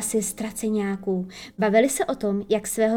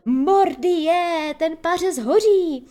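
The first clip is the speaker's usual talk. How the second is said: higher, louder, slow cry of alarm; alarmed pirate yell; 7 semitones higher, 5 dB louder, slower than usual. A narrator's reading. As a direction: yelp as the seat burns. Hook's trousers catch fire. (vyděšený výkřik, vyšší a hlasitější)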